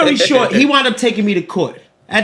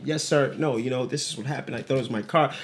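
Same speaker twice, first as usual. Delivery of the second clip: much softer, lower, meek to the officers; nice and humble; 10 semitones lower, 12 dB quieter than usual. Retold or imitated. Speaking politely to the police after the fight, soft meek voice. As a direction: shrink down and sound harmless. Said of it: "I was nice and humble".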